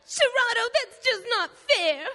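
high, slow crying whine; whining. Acted. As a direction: wail it like a sore loser. Caught villain whines at hero.

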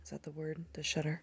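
unsure hushed question; whisper. Real, not acted. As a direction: quietly check the word. Unsure quiet check.

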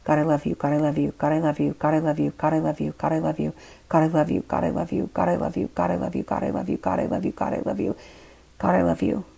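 rapid-fire chant, words run together; frantic repeated prayer. A narrator's reading. Narrator drowning out the devil thought in her head, frantic chant. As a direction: rattle it off over and over, creeping up in pitch. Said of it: "I was saying in my head".

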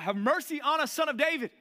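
high, pleading mock cry for mercy; calling out. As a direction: call out to be heard over the crowd.

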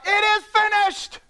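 slow, high triumphant cry; groom crying out. Retold or imitated. Groom calling out to the best man, slow triumphant cry. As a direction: draw it out high like a victory call. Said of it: "the groom would cry out".

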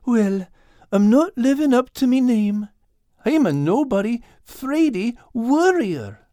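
high, self-deprecating Irish tomcat. Acted. Al owning he's a nobody, high humble Irish voice. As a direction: confess it humbly, drawing out the last words. Al belittles himself.

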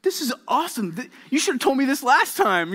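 put-on high, excited kid gushing; excited little boy voice; thrilled kid. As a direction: pitch up and gush like a happy child. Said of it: "It's like".

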